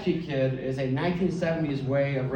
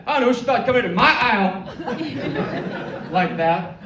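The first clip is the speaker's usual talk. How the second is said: much higher and louder, laughing through it; trash-talking fighter grandma; 8 semitones higher, 6 dB louder than usual. Retold or imitated. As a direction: brag like a boxer after the bout.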